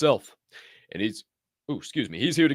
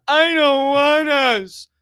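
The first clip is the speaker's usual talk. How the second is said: mock whiny voice; 12 semitones higher, 7 dB louder, much slower than usual. Mocking someone's reluctance.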